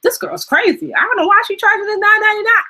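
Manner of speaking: high, fast mock-amazed viewer voice. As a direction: play a stunned fan who can't believe the price. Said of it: "leave you feeling like".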